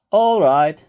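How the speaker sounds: put-on loud, rising call over noisy children; loud call for order. A narrator's reading. New teacher's boss calling the noisy class to order, loud. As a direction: call the room to attention.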